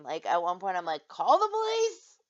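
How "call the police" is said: high, drawn-out yell; loud, shrieked retold demand; extreme overreaction. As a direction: cry out in mock alarm. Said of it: "And I'm extreme".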